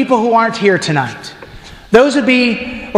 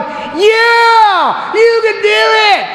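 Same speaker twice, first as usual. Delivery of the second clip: cheering crowd; 11 semitones higher, much slower than usual. Retold or imitated. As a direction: roar it like a thrilled crowd. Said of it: "And they're all going".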